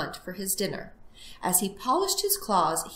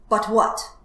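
loud, mildly gruff retort; testy storybook fox; impatient growl; 2 semitones higher, 8 dB louder than usual. Acted. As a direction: cut in like an irritated fox. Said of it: "growled the fox".

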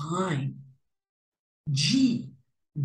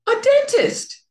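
high character voice; 5 dB louder than usual. Acted. Character answers at the door.

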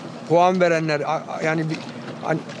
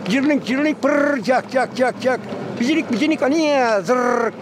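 imitating bad bird song; 5 semitones higher, slower than usual. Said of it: "Bunlar kuş değil".

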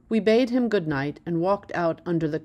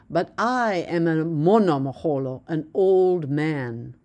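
put-on slow, deliberate words, an old man pressed out; emphasized slowly; 3 dB louder than usual. A narrator's reading. Old man stressing his age to the narrator, slow and firm. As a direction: lean on each word slowly. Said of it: "he emphasized slowly".

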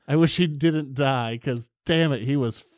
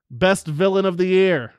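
announcer intro; 4 semitones higher, 6 dB louder than usual. Acted. Host announcing the topic.

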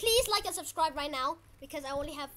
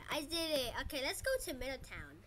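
ordinary delivery

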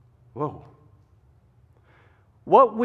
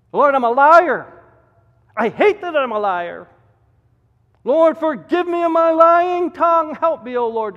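loud confession; 10 semitones higher, 11 dB louder than usual. Acted. Models a confessing prayer.

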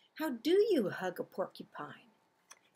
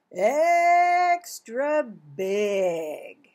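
drawn-out big; 7 dB louder, much slower than usual. Acted. Reading to kids, slowed and big. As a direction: stretch it slow and loud. Stretching word for big hug.

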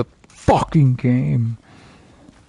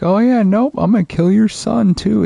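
high, louder mock villain; 9 semitones higher, 4 dB louder than usual. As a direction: sneer it out as the smug enemy. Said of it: "he just fucking was like".

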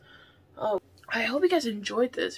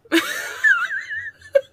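breathless high-pitched laugh; helpless squeal of laughter; 5 dB louder than usual. Real, not acted. Cracking up over the clue, high squealing laugh. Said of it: "why I was laughing so damn hard".

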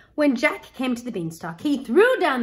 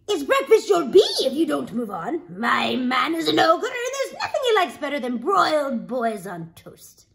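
put-on big woman warning off a boy, raised pitch; brusque ogre's wife; 3 semitones higher, 4 dB louder than usual. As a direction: scold and warn with relish, emphatic.

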